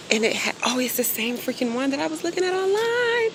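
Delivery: suddenly thrilled to find it; high squeal of real surprise; excited surprise. Real, not acted. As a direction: voice leaps way up in delighted shock. Spots the item she wanted.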